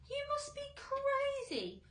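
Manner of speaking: very high scoffing worm voice; scornful earthworm. A narrator's reading. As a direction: sneer at the idea.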